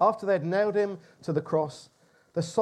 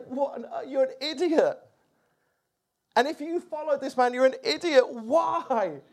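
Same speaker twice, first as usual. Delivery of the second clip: higher, louder scornful jeer; imagined mocking; 8 semitones higher, 4 dB louder than usual. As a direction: sneer at the fools who followed him. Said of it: "laughing at him and mocking".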